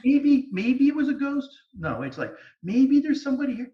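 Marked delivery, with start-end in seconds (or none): 0.0-1.5 high, loud, slow mocking whine; mocking doubter. Mocking a doubtful ghost hunter. 2.6-3.7 mocking doubter. Mocking a doubtful ghost hunter.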